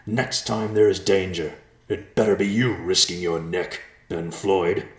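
a last furious threat at Ben; angry yell. A narrator's reading. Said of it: "he yelled at Ben one final time".

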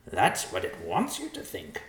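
high-pitched vulture character voice. A narrator's reading. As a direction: voice a bird high above your own register.

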